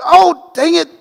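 mock groan at your own mistake; dismayed exclamation. Center sees the snap sail over, high comic dismay. Reacting to ball over head.

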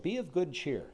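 impersonal notice, lightly put on; general statement. Bulletin board notice to everyone, impersonal general statement. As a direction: read it like a posted notice. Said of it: "It wasn't like a general statement".

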